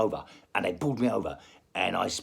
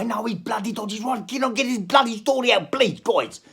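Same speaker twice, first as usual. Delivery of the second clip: put-on louder, higher plea in a South African accent; exasperated South African outburst; 9 semitones higher, 5 dB louder than usual. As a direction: beg the hecklers to let you finish. Exasperated plea to interrupting friends.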